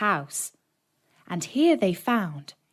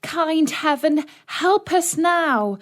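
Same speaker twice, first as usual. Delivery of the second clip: high, slow sobbing prayer; tearful plea; 8 semitones higher, slower than usual. A narrator's reading. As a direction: beg heaven through tears. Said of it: "she cried".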